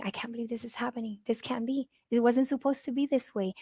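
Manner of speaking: soft, hushed murmur of disbelief; quiet inner disbelief. Retold or imitated. Grieving mother voicing her private thoughts, quiet. Catching ourselves thinking.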